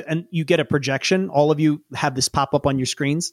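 ordinary talk, nothing marked

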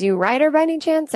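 high, quick eager question. Retold or imitated. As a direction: lean in eagerly. Eager masseur.

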